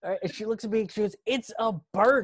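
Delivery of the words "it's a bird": higher, louder retort; exasperated insistence. Retold or imitated. Fiancée insists to panicking man, exasperated. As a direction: spell it out, mock exasperation.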